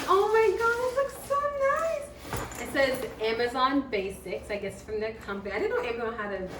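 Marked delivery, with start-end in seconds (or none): 0.1-2.1 high, gushing delight; rising high-pitched gush; excited squeal. Real, not acted.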